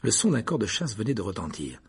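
plain reading voice

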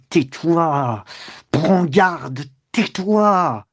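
drawn-out, menacing commands, rising; exasperated warning. A narrator's reading. Man itching to strike, warning her in exasperation. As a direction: warn through mounting rage. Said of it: "ce mensonge l'exaspérait".

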